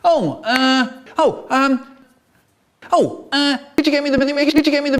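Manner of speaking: high, fast, flustered inspector voice; high squeaky inspector. Acted. Comic inspector stammering at class, high and hurried.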